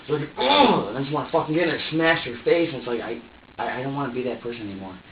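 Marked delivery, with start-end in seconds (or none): 0.4-0.9 high, loud "ugh" of pent-up rage; frustrated growl-out "ugh". Retold or imitated. Acting out the urge to smash someone, frustrated outburst. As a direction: let out an exploding "ugh".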